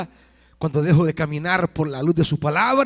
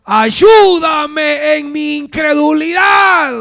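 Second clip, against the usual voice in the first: long, high, drawn-out plea yelled out; preacher wailing the father's plea; acted desperate shout; 11 semitones higher, 11 dB louder, much slower than usual. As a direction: cry for help at full voice, very slowly. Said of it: "Pero a la vez gritó".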